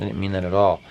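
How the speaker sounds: ordinary talk, nothing marked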